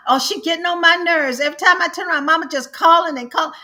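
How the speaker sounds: high, loud exasperated mimicry; mocking complaint. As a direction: whine it like a fed-up daughter.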